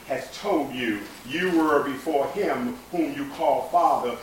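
slow, measured spoken-word poem; poetry reading. Acted. Reads Nikki Giovanni poem.

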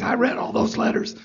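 husky, soft imitation of a hushed, raspy voice. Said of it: "you know, his husky voice".

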